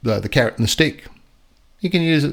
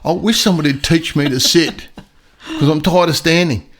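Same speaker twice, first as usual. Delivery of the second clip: high, louder put-on dog voice; voicing the dog; 5 semitones higher, 4 dB louder than usual. Trainer voices the standing dog in a high, louder complaining voice. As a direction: play the weary dog begging to sit. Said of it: "he was standing there going".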